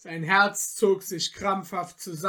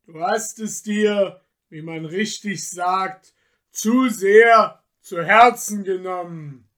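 louder, mocking forest spirit; scornful tempter; 7 dB louder than usual. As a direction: lecture him with contempt. Michel lectures Peter scornfully.

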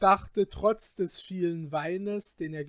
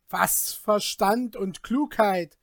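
loud, slow, indignant retort; indignant cry; 4 semitones higher, 8 dB louder than usual. Acted. Peter retorts to the Glasmännlein, loud and indignant. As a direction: throw the words back at him. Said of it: "rief jener".

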